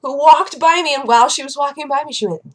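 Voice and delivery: normal talking voice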